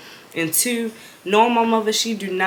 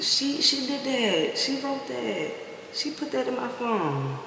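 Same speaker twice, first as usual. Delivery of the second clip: much softer, slow feeble excuse; weak protest; 4 semitones higher, 9 dB quieter than usual. Retold or imitated. Daughter meekly blaming the girl to her mother. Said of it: "I was like".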